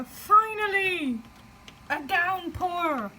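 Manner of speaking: high, loud, drawn-out cry of relief; elated announcement. Real, not acted. Vlogger interrupting reading as the heatwave breaks, jubilant cry. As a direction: proclaim it like a long-awaited event.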